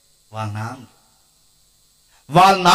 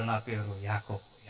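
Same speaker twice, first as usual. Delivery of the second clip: slow, hushed, much lower and softer; tearful confession; 9 semitones lower, 13 dB quieter, slower than usual. Retold or imitated. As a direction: admit it through mock tears. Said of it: "అప్పుడు ఏడుస్తా అన్నాడు".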